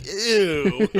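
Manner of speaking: high squeaky ew; mock disgusted ew. Friend teasing the new dad about cutting the cord, high mock ew. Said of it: "I heard you were like".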